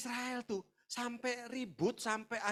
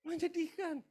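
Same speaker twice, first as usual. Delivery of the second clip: high, mock-sad voice; sedih pura-pura; 8 semitones higher, 4 dB quieter than usual. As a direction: put on sadness in a raised voice. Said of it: "sedih kan?". (pura-pura sedih, bernada tinggi)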